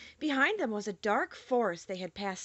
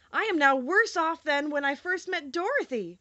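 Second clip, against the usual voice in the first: Scarecrow's lament; 7 semitones higher, 4 dB louder than usual. Acted. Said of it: "he thought".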